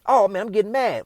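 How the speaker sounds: ordinary talk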